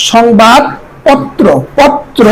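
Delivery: সংবাদপত্র in slow pieces, ending "pot-tro"; syllable-by-syllable pronunciation. Shown as a demonstration. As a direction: carefully split সংবাদপত্র and repeat পত্র. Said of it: "উচ্চারণ হবে সংবাদ". (সংবাদপত্র ধীরে ভেঙে উচ্চারণ)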